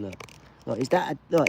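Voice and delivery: normal talking voice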